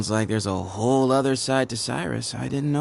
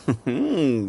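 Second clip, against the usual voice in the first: short amused laugh. Acted. Guide chuckling at a wary traveler, a short amused laugh. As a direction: laugh him off, knowingly.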